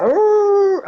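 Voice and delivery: a high growled howl attempt; half-hearted wolf howl. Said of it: "[name], come on, do this".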